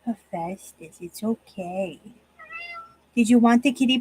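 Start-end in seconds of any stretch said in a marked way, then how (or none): 0.0-2.0 soft, low reassurance to the cat; calm him down gently; soothing. Real, not acted. Soothing cat after scolding.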